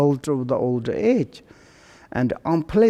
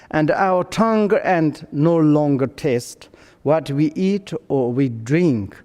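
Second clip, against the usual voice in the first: usual speaking voice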